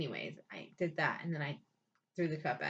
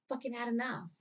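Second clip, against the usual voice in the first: ordinary talk, nothing marked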